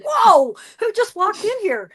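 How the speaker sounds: high, loud surprised whoa; startled spirits. Pretend. Spirits startled by Shelly's entrance, loud surprise. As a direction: jump back in shock. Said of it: "They'll be like".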